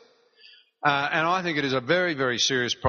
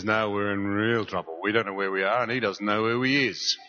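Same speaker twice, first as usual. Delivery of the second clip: punchline soldier; 5 semitones lower than usual. Retold or imitated. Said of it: "Jimmy looks at Billy and says".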